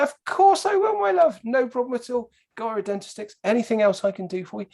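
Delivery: very high-pitched, gushing helpfulness; eager to help. Retold or imitated. Shopper replying to old lady at Tesco's, acted-out high eager helpfulness. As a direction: pitch it right up, falling over yourself to oblige.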